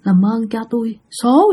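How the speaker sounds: mildly acted frightened begging; trembling plea. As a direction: plead with a touch of fear. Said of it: "trả lời mà run run nói". (van xin hơi run sợ)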